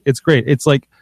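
ordinary talk

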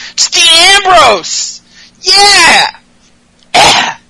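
over-the-top joyful introduction; mock ecstatic intro. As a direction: scream his name like a thrilled fan. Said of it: "It's hurting my throat being this happy".

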